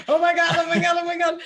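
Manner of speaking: high, loud frantic repeating; hammed-up overwhelmed chanting; mock panic. Reenacts herself overwhelmed while tapping.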